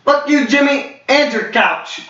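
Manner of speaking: high, mocking put-on voice; coworker's insult. Coworker mocking Jimmy in passing, high sneering voice. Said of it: "she's like".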